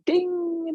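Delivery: high, sustained sung-out ding; calendar chime. Retold or imitated. Calendar alerting her before class, chime imitation. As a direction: imitate a bright reminder bell, drawn out.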